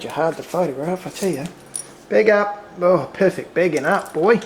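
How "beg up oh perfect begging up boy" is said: loud, higher praise for a good dog. Real, not acted. Owner praising Bobby for begging up, loud. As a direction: reward him with big praise.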